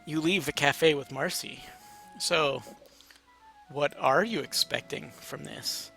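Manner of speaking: usual speaking voice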